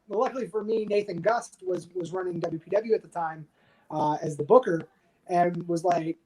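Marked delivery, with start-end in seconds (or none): none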